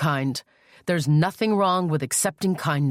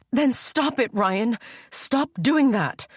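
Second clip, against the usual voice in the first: high, desperate pleading; frightened plea; 7 semitones higher, slower than usual. Acted. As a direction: beg him to stop, scared. Sister pleads as he hurts himself.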